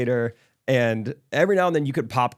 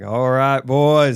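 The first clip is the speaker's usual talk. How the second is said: put-on louder, booming call to the boys; rally the huddle with a big shout; hearty call; 6 dB louder, much slower than usual. Kenny answering the boys' huddle call, booming. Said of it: "Kenny's like".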